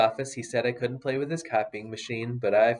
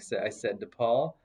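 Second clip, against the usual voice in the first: usual speaking voice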